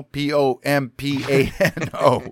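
slow, deliberate letter-by-letter spelling. Real, not acted. Reader spelling a town's name for co-host, letter by letter. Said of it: "just spell out everything".

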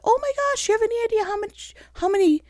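imitating a scandalised audience; mock shocked viewer. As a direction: gasp at her like a shocked viewer. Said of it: "some of you just went".

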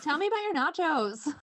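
insist, playfully impatient; insistent. Real, not acted. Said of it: "I just told you to tell me".

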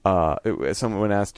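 normal talking voice

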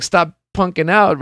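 a hint of a fired-up cornerman; corner man pep talk. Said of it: "corner man's really just".

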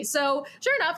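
ordinary delivery

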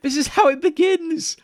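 high, loud mock-horrified cry; mock alarm. Co-host reacting to pet-voicing confession, loud mock alarm. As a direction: cry out in pretend dread.

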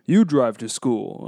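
lightly imitated action star, drawn out and forceful; Vin Diesel. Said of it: "Vin Diesel is like".

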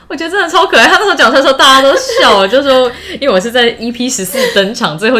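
high, loud and fast through laughter; laughing. Real, not acted. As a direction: gush while laughing. 笑着回忆学弟登场. (边笑边说)

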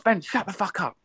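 high, hammed-up wife's snap; mock furious wife. Husband voices his wife in labour telling him to shut up, mock angry. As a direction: imitate her cutting him off high. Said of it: "She just".

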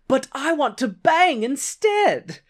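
high, drawn-out petulant whine; hammed-up sulky objection; mock whining protest. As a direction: whine it slowly like a thwarted suitor. Cousin protests to showgirl, acted.

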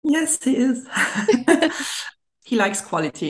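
usual speaking voice

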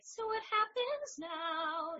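put-on high, slow sung little-girl voice; cartoon princess. Shown as a demonstration. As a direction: sing it like a tiny princess. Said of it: "I'd have, like, a smaller voice".

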